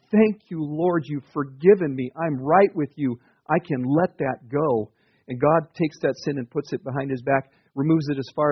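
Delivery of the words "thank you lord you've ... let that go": slow, emphatic thanks to God; raised, drawn-out cry of relief at being forgiven; grateful declaration. Acted. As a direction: declare it with relief, stretching the words. Acted grateful prayer of someone forgiven.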